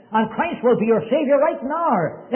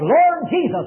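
higher, louder desperate cry; 6 semitones higher, 3 dB louder, slower than usual. Retold or imitated. Young man on his bed crying out to Jesus, louder and higher. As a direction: call out to the Lord from the heart. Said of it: "cried out".

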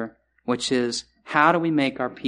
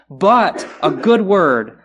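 "but" punched loud, then a slow, raised "a good word"; emphatic BUT; 4 semitones higher, 7 dB louder, much slower than usual. Real, not acted. Preacher turns the proverb from anxiety to hope, emphatic. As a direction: hit "but" hard and stretch the phrase out.

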